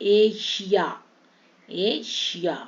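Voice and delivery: "एशिया" as ay - shiya, syllables separated; ia as iya. Shown as a demonstration. Teacher modelling i-a ending, deliberate syllables. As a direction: hold the first vowel of "एशिया", then shiya. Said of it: "ईया भी बोलेंगे".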